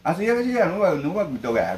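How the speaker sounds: hammed-up Korean-flavoured Okinawan dialect; Okinawan dialect Korean-style. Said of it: "韓国風に沖縄弁使って".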